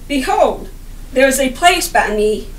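ordinary talk, nothing marked

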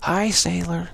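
high-pitched mock come-on; flirty greeting. Feminine luring a sailor, flirty mock greeting. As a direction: call it out like a temptress. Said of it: "in the situation of".

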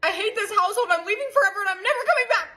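put-on teen tantrum. Little sister throws a tantrum at her sibling, high and whiny. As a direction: storm off in a huff.

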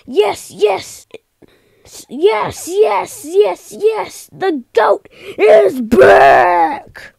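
high, loud eager yes voice; character voice. Acted. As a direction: squeak out an eager character's yeses. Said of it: "He said".

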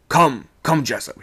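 loud, high remonstrance; exclaiming. A narrator's reading. Second mate to refusing sailor, exclaiming. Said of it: "he exclaimed".

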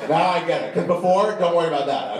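high, eager, put-on understanding; eager realisation. Comic assures the trooper he understands now, eager and high. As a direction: rush to show the penny has dropped, high-pitched.

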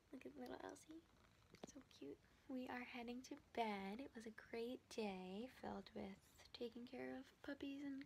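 hushed night-time whisper. Real, not acted. Saying goodnight to viewers beside sleeping pets, whispering. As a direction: whisper so the sleeping animals stay asleep.

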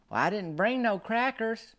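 innocent granny reply. Retold or imitated. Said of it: "she said".

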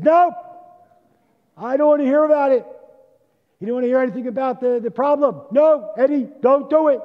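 a president flatly shutting his friend down at full voice; angry refusal. Acted. Truman shouting down his pleading friend Eddie, high and loud.

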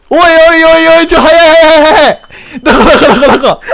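loud frantic panic; panicked yelling. Real, not acted. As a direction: scream it in a rush of panic. Panicked chase in horror game.

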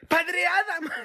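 a high call of his name across a crowd; a surprised high shout of recognition. Retold or imitated. Stranger calls to him abroad.

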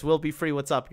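usual speaking voice

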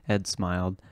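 plain reading voice

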